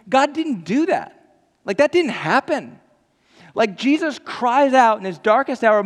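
high, loud, emphatic; fervent preaching. Real, not acted. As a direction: hammer it home with full force. Impassioned preaching climax.

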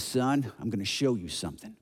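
low, soft dad voice; hushed father. Acted. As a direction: play a father speaking secretively in the dark. Dad speaks low in dark.

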